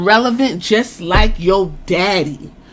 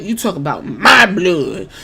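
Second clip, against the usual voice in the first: "my blood" slowed and leaned on; stress on "my"; 4 semitones lower than usual. Retold or imitated. Rock warning Cody about family, slow stress on "my blood". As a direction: stretch "my blood" as a possessive warning.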